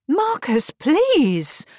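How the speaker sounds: high, loud, urgent plea; admonishing. A narrator's reading. Wilhelmina tries to check her shouting husband, admonishing. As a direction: admonish him, voice lifted high. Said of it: "Wilhelmina admonished".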